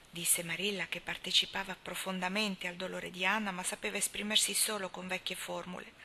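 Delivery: plain reading voice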